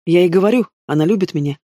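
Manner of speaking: plain reading voice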